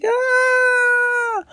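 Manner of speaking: high shrieking aah of fright and fun; high scream. Acted. Raccoon flying the rocket bike, high shriek. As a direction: shriek while flying out of control.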